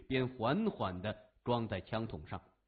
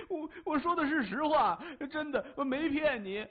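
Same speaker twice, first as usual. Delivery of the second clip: much higher, stammering and desperate; terrified pleading; 12 semitones higher than usual. A narrator's reading. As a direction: swear you're honest, scared stiff. Terrified captive swears truth.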